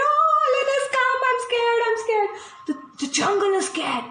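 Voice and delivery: high, sing-song frightened animal voice; scared animals. Acted. Jungle animals fearing Alan the alligator, frightened high voice. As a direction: squeal it out like trembling jungle creatures.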